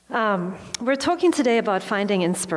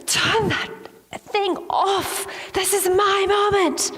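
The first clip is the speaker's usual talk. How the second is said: mock angry yell; 12 semitones higher, slower than usual. Friend yelling at the audience over a ringing phone, furious. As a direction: bark it at the audience in outrage. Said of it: "in huge anger".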